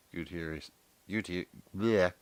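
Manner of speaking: ordinary delivery, nothing marked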